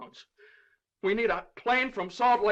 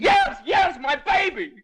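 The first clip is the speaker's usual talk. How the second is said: high, loud, desperate cry; anguished shout; 10 semitones higher, 6 dB louder, slower than usual. Acted. Father to judge about his baby, anguished shout.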